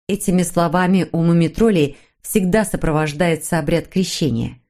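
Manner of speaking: ordinary delivery, nothing marked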